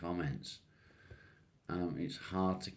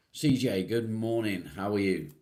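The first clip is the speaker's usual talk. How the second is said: loud called-out greeting; 3 semitones higher, 8 dB louder than usual. Real, not acted. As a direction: greet them louder, voice up. Greeting a viewer by name.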